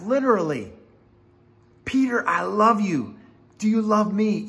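high-pitched, heartfelt declaration of love; intimate pleading. Acted. Jesus in intimate conversation with Peter, heartfelt pleading. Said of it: "he's having this intimate conversation with Peter".

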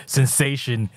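usual speaking voice

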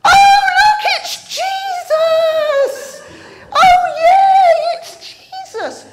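high, loud cry of delighted recognition; thrilled high shout, laughing at the end; excited shout. Acted. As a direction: yell it out like you've spotted a friend across the water. John spots Jesus from boat.

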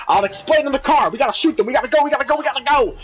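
high, insistent urgency; urgent alarm. Acted. Comrade hurrying partner out under attack, urgent alarm. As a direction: hurry them out, pressing harder with each repeat.

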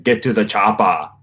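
put-on light German-Austrian accent on the quote; a hint of Schwarzenegger's Austrian accent; Schwarzenegger impression. Explaining German R's, a mild Austrian accent quote. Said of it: "like Arnold Schwarzenegger".

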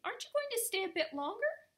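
very high, piping little voice; a tiny creature's high fluttery "oh dear"; piping Timmerie. Acted. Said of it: "piped Timmerie".

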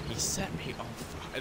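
slow, soft, distant-sounding quote; faded away. Retold or imitated. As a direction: mimic a voice fading into distance. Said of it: "faded away".

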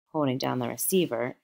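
ordinary delivery

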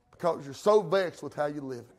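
normal talking voice

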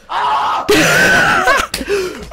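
loud, high, strangled-sounding shriek; mock choking scream. Imitating the man choking on grapes, mock scream.

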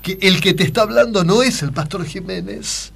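high pastor voice revealing the prank. Retold or imitated. Confessing the prank to the host in a high pastor voice. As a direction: stay in the pastor as you reveal it. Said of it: "la imitación del Pastor Jiménez".

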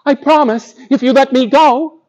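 high, earnest mouse promise; pleading mouse. Acted. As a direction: promise sweetly as a tiny mouse.

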